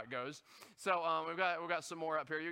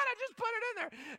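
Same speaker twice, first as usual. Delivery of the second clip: mock disbelief. Arguing with self-checkout.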